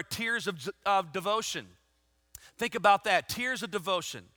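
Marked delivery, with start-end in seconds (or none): none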